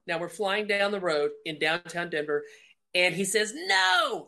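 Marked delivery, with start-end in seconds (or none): 3.5-4.3 high, drawn-out possessed no; demon voice. Retold or imitated. Possessed kidnapper refusing her second question, demon voice. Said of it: "like that".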